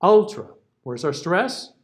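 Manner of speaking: ordinary talk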